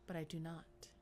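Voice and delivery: measured, low-key story narration; hushed suspense narration. A narrator's reading. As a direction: read it like a tense audiobook, with pauses. Reads game story aloud.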